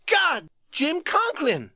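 high-pitched, drawn-out cry of shock; anguished cry. A narrator's reading. Youth spots his wounded friend, crying out. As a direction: cry out the name, high and slow. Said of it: "the youth cried".

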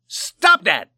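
loud, high sharp command; shouting. A narrator's reading. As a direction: shout to stop him. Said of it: "shouted the saloon keeper".

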